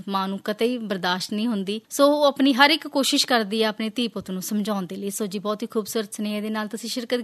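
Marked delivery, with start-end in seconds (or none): none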